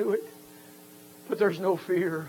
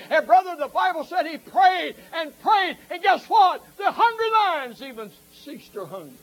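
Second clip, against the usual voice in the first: high, loud revival preaching; shouted camp meeting sermon; fiery preaching; 8 semitones higher, 4 dB louder than usual. Acted. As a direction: preach it hard to the congregation. Preaching at full force.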